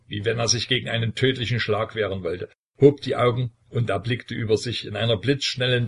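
usual speaking voice

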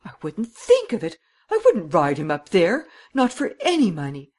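high, emphatic refusal; acted distressed protest; tearful passion. A narrator's reading. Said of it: "sudden passion of unshed tears".

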